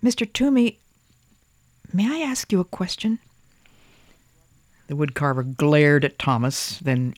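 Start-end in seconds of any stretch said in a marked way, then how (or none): 0.0-3.2 put-on high, timid little boy; child voice. A narrator's reading. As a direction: ask shyly as a small boy. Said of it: "Thomas cleared his throat and whispered".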